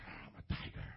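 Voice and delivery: soft, whispered mock growl of a flirt; whispered flirty tiger. Pastor voicing a guy eyeing a cute lady, whispered. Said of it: "he's like".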